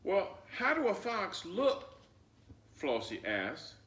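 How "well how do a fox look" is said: put-on much higher, curious child voice; little girl asking. Flossie asking her grandmother about the fox, high and curious. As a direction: ask like a puzzled little girl.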